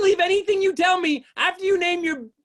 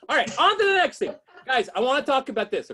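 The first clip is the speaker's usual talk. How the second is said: usual speaking voice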